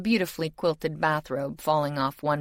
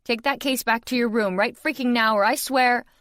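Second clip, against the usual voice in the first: higher and louder, furious command; angry order; 7 semitones higher, 5 dB louder than usual. A narrator's reading. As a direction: lay down the law, furious. Narrator voices her angry order.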